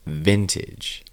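usual speaking voice

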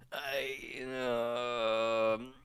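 long pauses between drawn-out fillers; stalling hesitation. Retold or imitated. Bard put on the spot by his captor, haltingly stalling. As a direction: stall helplessly, lost for an answer.

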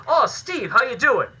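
high-pitched, badly faked British greeting; terrible British accent. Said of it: "That's my terrible British accent".

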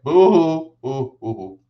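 mocking boo-hoo sobs; sarcastic fake whimper; fake crying. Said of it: "I'm like".

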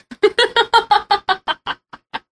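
high Disney villain cackle; evil cackle. Real, not acted. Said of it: "Listen to that cackle. ... very evil, like straight up Disney villain".

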